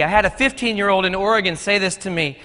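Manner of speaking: ordinary talk, nothing marked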